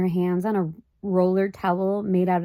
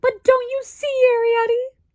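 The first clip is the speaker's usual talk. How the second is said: a frightened mother gasping out; much higher anxious gasp; gasping plea; 6 dB louder than usual. A narrator's reading. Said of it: "gasped Homley".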